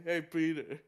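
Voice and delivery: a light take on Joe Swanson; Joe impression.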